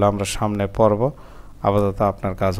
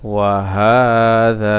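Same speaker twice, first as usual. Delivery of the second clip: joined recitation; 3 dB louder, much faster than usual. Shown as a demonstration. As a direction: join it up with long held vowels. Teacher joins the syllables up.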